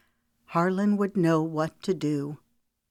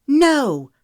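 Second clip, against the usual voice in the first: high, loud furious cry; woman refusing to be silenced; fevered shout; 10 semitones higher, 9 dB louder than usual. Acted. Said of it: "Her voice rose to a fevered pitch".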